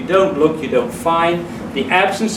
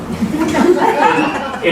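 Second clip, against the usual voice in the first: nervous laugh; 11 semitones higher than usual. Real, not acted. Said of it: "attempt an Irish accent".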